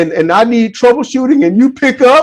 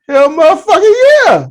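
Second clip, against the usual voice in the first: mock emphatic yes; 9 semitones higher than usual. Acts out punchline of a commercial.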